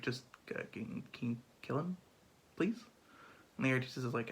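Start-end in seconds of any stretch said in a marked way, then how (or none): 0.0-2.8 sly quiet ask. Retold or imitated. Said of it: "Claudius is like".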